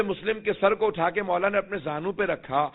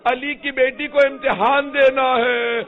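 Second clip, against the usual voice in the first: slow, high, ringing summons to the companions; a warrior's rallying call, declaimed from the pulpit; calling out loud; 6 semitones higher, 8 dB louder, slower than usual. Acted. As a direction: call them out of the tents at full voice. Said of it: "جناب حبیب نے آواز دی".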